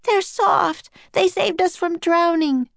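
high pleading protest; crying out. A narrator's reading. Annie pleading for the octopus, crying out. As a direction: argue back in a high young voice. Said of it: "cried Annie".

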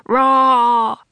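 loud gorilla roar. Acted. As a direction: roar like a silverback to scare off a leopard. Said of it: "he roared".

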